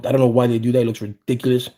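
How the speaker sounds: usual speaking voice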